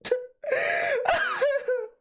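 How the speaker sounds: put-on a boy bursting into tears at school; mock sob. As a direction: bawl like a kid in class. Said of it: "started spontaneously crying".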